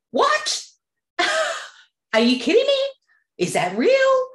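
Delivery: thrilled, can't believe the news; squealing with delighted disbelief; excited disbelief. Retold or imitated. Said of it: "You'd probably change your state in an instant".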